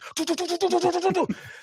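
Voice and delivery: high, rapid-fire stuttering gun noise; imitated gunfire. Retelling YouTuber shooting guns, imitated gunfire. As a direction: rattle off machine-gun shots with the mouth.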